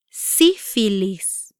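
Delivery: "Sífilis" slowly, syllables separated; "Sífilis" broken into drawn-out syllables; slow pronunciation demo. Shown as a demonstration. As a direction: stretch out "Sífilis" for learners. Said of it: "try pronouncing these words".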